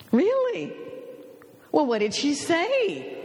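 high-pitched, avid questioning; retold eager curiosity. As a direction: leap up high, keen for news. Wife eagerly asks about the call.

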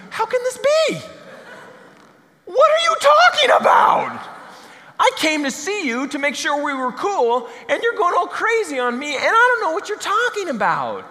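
flustered Nicodemus. Acted. Nicodemus, baffled by Jesus, in a squeaky girlish panic. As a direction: shriek it out in a high, panicked voice. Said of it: "Why do I sound like I'm a thirteen-year-old girl".

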